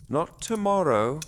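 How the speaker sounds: mother firmly putting off the outing. Acted. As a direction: shut the idea down kindly but firmly. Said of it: "Liv's mother said firmly".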